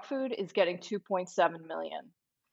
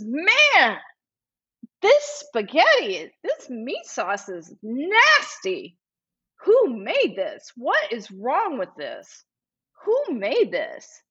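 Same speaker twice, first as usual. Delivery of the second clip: higher, slow, incredulous complaint; stretched-out, high-pitched mock outrage; retold indignant disgust; 4 semitones higher, slower than usual. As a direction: act out drawn-out disgust at a nasty meal. Acting out man disgusted by food.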